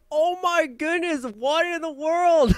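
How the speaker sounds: high, loud and incredulous; astonished disbelief. Real, not acted. Reactor stunned by what an animatronic did, incredulous. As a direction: stretch out the shocked exclamations at the end.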